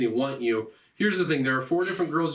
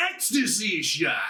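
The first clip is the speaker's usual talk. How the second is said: drawn-out mock announcer shout; game announcer. Game announces the special shot, mock-heroic call. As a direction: call it out slowly like a game sound. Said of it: "and then it goes".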